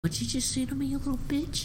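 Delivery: high-pitched incredulous comeback; mock indignant challenge. Falcone answering Oz's insult, high mock indignation.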